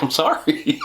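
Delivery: giggly, half-serious apology; laughing mock apology. Answering angry commenters, laughing mock apology.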